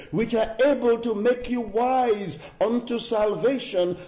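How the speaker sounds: high, ringing scripture quotation; impassioned preaching. Real, not acted. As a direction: proclaim scripture from the pulpit, pitched well up.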